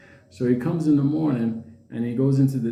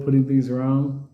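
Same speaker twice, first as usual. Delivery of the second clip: usual speaking voice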